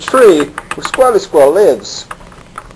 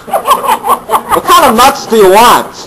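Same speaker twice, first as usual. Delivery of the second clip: high, slow, laughing through the offer; can't keep a straight face asking; 7 semitones higher, slower than usual. Acted. Squirrel offering nuts to the camel, laughing.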